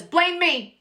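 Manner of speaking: high, exasperated cry; dramatic blame me. Retold or imitated. Accused housewife at dinner, high exasperated cry. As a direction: fling it out like a martyr.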